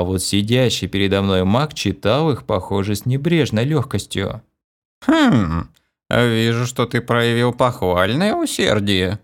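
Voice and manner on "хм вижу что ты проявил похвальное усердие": put-on old mage softening, pleased; warming up. A narrator's reading. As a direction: let the old voice warm slightly. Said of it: "Голос мага явственно потеплел".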